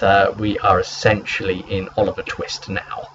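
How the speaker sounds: ordinary delivery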